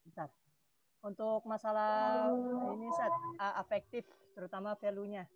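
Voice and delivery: faint, distant voice; talking away from the microphone; far from mic. Real, not acted. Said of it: "terlalu jauh suaranya".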